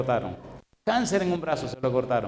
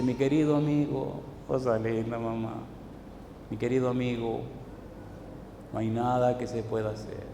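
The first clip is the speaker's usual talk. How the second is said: sad doctor; 4 semitones lower, 8 dB quieter than usual. Retold or imitated.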